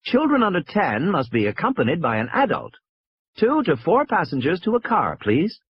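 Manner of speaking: high-pitched, repeatable boarding notice; recorded announcement. A narrator's reading. Recorded voice instructing passengers at the cars, announcing. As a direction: announce the rules like a recorded message. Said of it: "a recorded voice was saying".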